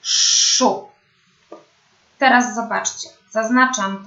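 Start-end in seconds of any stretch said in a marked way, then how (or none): 0.0-0.9 "szu" with a long hushing sz before the vowel; drawn-out SZ. Shown as a demonstration. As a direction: model the syllable "szu", sz held long.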